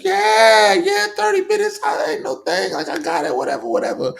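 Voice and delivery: very high, squeaky cocky voice; mock overconfident. Mocking a character promising to hold the fort, squeaky high. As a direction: brush it off in a squeaky falsetto.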